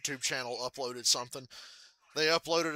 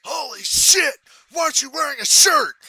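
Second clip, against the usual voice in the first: much higher, louder outrage; shocked shout; 12 semitones higher, 13 dB louder than usual. Retold or imitated. Dad scolding his shirtless son, shocked shout. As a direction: yell it in disbelief.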